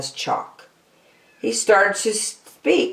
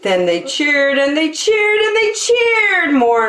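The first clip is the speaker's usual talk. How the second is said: rising cheer; 10 semitones higher than usual. A narrator's reading. Crowd cheering.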